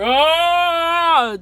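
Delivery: high, drawn-out "oh" yell; long mock-frightened wail; acted falling cry. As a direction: cry out as if dropping from a height. Daddy Pig afraid of heights.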